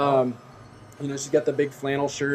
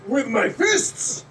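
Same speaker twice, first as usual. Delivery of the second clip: put-on higher, slowing accented strongman boast; strong man; 5 semitones higher, slower than usual. Strong man answering a magician's trick, drawn-out accented boast. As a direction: boast in a foreign accent, drawing out the end. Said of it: "He says".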